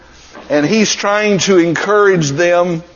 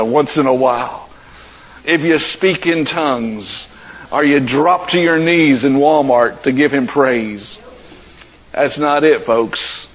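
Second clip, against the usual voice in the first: normal talking voice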